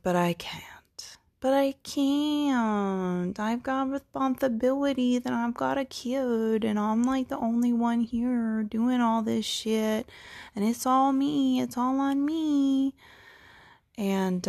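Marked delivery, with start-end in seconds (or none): 1.4-3.4 high, drawn-out self-pitying whine; mock whining. Protesting the need to change life, mock whining. 3.4-12.9 high, drawn-out self-pitying whine; mock whining. Overburdened parent protesting any change, mock whining.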